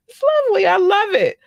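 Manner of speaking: high, loud delighted squeal; gushing. Real, not acted. Host delighted by the listener list, gushing high and loud.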